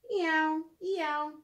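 light playful kitty meows; cat meow. Pretend. Tomcat noises in storybook.

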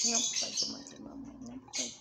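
whispered, gentle telling-off; soft whisper; whispered scolding. Real, not acted. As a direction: hush the bird while scolding it. Scolding the bird softly.

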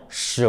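시 rising to high; second tone. Shown as a demonstration. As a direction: lift 시 like a question. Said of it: "이성".

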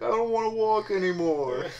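put-on high whiny tired child; whiny kid voice. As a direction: whine it like a worn-out kid. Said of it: "one kid that was like".

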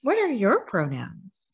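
plain reading voice

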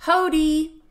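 loud high call of Hodi!; shouting. A narrator's reading. Guests calling out at the door, loud and high.

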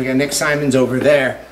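ordinary talk, nothing marked

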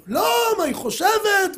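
the driver defending himself, heated; exasperated driver. Retold or imitated. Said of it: "driver complains to rabbi". (נהג מונית מתלונן לרב בהתרסה)

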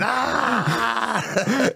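high fake scream, half laughing; mock scream. Faking pain while beaten on the estate, laughing mock scream. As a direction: fake a scream you don't feel. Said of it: "I just started going".

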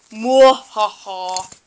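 low, slow fake evil laugh; put-on evil cackle; forced villain laugh. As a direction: drop low for a half-hearted "mwahaha". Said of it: "Oh, I can't laugh".

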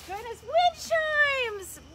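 it's the gift she hoped for; delighted surprise. Real, not acted. Vlogger unwrapping wind chimes from Casey, thrilled.

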